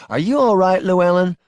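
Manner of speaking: high, loud, anxious question; worried wife. Acted. Wife checking on her missing husband, worried.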